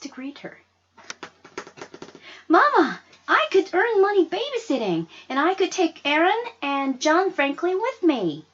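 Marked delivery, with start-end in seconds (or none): 2.5-8.5 put-on high, loud, eager plea of a young girl; excited daughter pleading to babysit for money. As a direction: beg Mama with bright hope.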